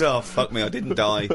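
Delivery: low, glum imitation of a moaner; mock moaning. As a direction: moan it low, as if waking up disappointed. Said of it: "it starts off moaning".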